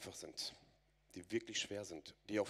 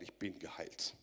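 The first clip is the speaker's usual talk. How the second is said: ordinary delivery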